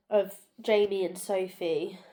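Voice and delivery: usual speaking voice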